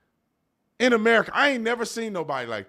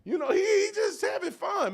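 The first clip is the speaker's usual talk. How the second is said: hyped-up imitation. Imitating Elon's stage energy.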